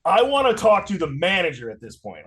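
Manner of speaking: emphatic annoyed complaint; pissed off. Retold or imitated. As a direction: demand the manager, really fed up. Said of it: "Like, I'm pissed".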